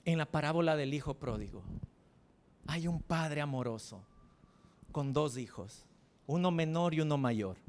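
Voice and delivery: ordinary delivery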